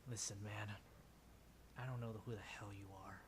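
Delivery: low, quiet brush-off; dismissive NPC. Acted. NPC tells the strapped-down kid off in a low mutter. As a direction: wave the kid off, lightly voiced. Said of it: "gives you the look like".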